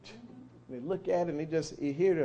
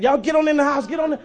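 calling the chicks inside, raised; mother hen calling; 8 semitones higher, 9 dB louder, faster than usual. Acted. Mother hen calls her chicks into the house. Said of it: "mother hen hear that".

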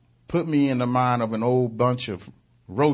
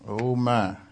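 ordinary delivery

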